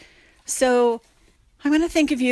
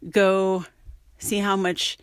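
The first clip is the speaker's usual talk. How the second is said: plain reading voice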